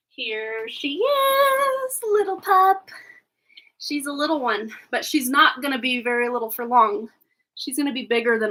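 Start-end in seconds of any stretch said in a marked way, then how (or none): 0.1-2.9 much higher, very slow cooing at the puppy. Real, not acted. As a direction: coo over the little pup as she arrives. Greeting the new puppy.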